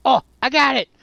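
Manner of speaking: loud, high burst of discovery; excited realization. Acted. Player realizing the teddy is the key, excited shout. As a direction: exclaim it as the answer hits you.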